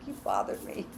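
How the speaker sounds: sulky pet, dropped low and quiet; voicing the pet. Reader voicing her upset pet complaining about being bothered, low mock grumble. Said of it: "She's like".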